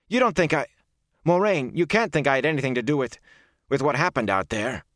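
high, agitated pleading; alarmed plea. Acted. Rand begs Moraine to believe him, alarmed.

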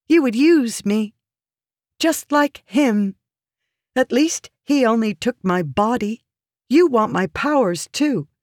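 high, accusing woman's voice. Acted. Woman shaming her protector for using her, accusing. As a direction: accuse him with pointed, high-pitched intensity.

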